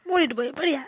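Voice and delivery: loud irritated snap back; wife loudly telling her husband to shut up and sleep; angry retort. Acted. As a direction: tell him off sharply and loudly.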